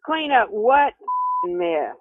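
ordinary delivery

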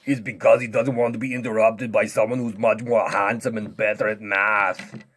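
loud, cocky strongman voice; boastful strongman. Pretend. Strongman interrupts the whispered lesson, loud and boastful. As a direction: boast at full volume, cutting in.

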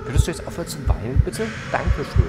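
fast, loud and fed up; mock-polite irritation; exasperated demand. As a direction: snap at the crying ghost to stop, then a curt thanks. Fed up with the crying.

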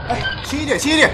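high urgent call to be careful. Acted. Worker warning men carrying heavy steel plates, urgent call. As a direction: warn the movers sharply to go gently.

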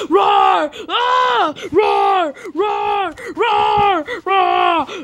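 high, repeated shrieky roars; high-pitched pretend monster bellows; scary monster roar. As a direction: roar over and over to scare them. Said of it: "I will scare them".

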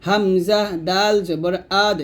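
spelt out evenly, final দ of আদ bounced; qalqalah on dal. Shown as a demonstration. Teacher showing learners qalqalah on dal, rebounding দ in আদ. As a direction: release the দ in আদ with a short echo. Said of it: "কলকলা".

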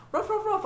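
high-pitched little dog barking; three high mock barks; imitated dog barks. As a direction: yap like a small dog. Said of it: "he was barking the whole time".

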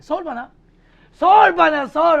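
loud high insisting; mock demand. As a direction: demand the question loudly. Teacher demanding a question. (yüksek sesle ısrar)

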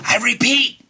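high, loud emphatic; insistent. Acted. Lorax insists to the Onceler, loud and firm. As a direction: bark it like a stern warning.

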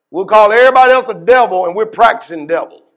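rising preacher's rebuke; heated preaching. Acted. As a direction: drive it home with fire. Preacher keeps the heat up.

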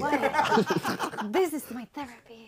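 loud, laughing exclamation. Real, not acted. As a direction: burst out laughing. Laughing exclamation about cursing.

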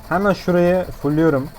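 ordinary talk

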